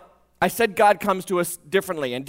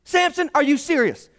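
disbelief; 8 semitones higher than usual. Retold or imitated. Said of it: "you're like".

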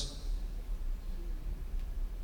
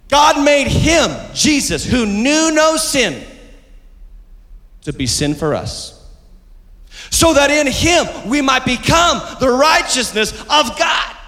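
preaching scripture; 10 semitones higher than usual. Real, not acted. Reading scripture with force.

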